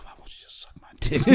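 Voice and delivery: whispered, breath-heavy imitation of the old man; deep-breath old man. Old man asks his aide, breathy mock plea. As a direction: let it out on a hushed, quick breath. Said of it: "when he took that deep breath".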